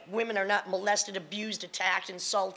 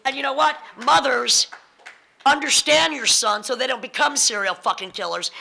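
higher and louder, rallying the room; impassioned call-out; 6 semitones higher, 9 dB louder than usual. Real, not acted. Telling mothers about their sons, louder and higher. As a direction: preach it at the mothers.

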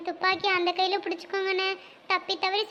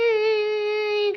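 fake sob; 4 dB louder, much slower than usual. Fake sob in staged grief.

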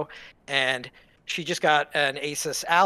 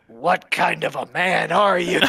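slow, louder, put-on voice of a scornful old German waiter; judgmental waiter; 5 semitones higher, 8 dB louder, slower than usual. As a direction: sneer it out like a disgusted old waiter. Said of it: "he looks at me and he goes".

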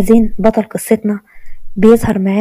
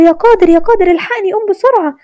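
high-pitched pretend cry for help; mock screaming; 10 semitones higher than usual. A narrator's reading. Sister waking her brother with a prank, mock screaming. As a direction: call out in mock panic, much higher. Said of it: "قعدت تصرخ عليه".

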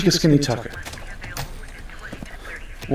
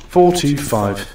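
ordinary talk, nothing marked

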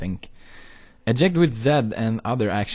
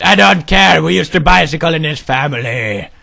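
louder, overruling dad voice; stern father; 4 semitones higher, 6 dB louder than usual. Acted. Father dismissing son's wish for skis, stern and loud. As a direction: shut the objection down as a dad.

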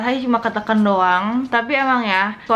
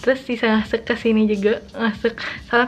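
talking with lip pulled down; speaking while showing teeth; finger in mouth. Real, not acted. Seen 1: Fingertip pressed at teeth, pulling lower lip down while talking.

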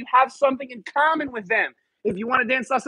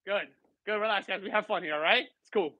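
reassuring; 4 semitones lower, 6 dB quieter than usual. Real, not acted. Calming nervous new students.

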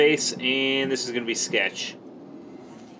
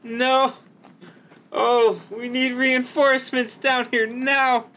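high, slow desperate call; urgent plea; 11 semitones higher, slower than usual. Real, not acted. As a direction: beg for backup. Stranded, calling for help.

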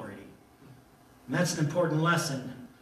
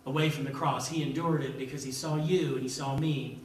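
ordinary talk

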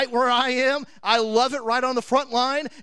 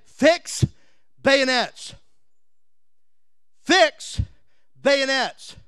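commanding order; 3 semitones higher, 4 dB louder, much slower than usual. Acted. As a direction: bark the order down the line. Colonel orders men before charge.

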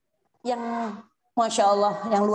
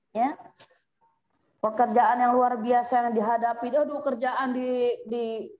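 ordinary talk, nothing marked

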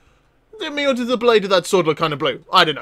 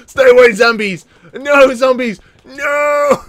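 loud, higher panicked yells at the zombies; louder, higher mock fright; mock panic shout; 6 semitones higher, 7 dB louder, slower than usual. Real, not acted. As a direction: yell in playful terror. Fleeing zombies.

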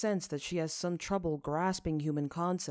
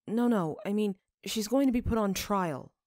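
louder, higher flustered denial; flustered protest; 5 semitones higher, 6 dB louder than usual. A narrator's reading. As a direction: caught off guard, rush to deny it. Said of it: "Asbel chokes".

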